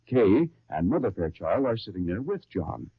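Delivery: ordinary talk, nothing marked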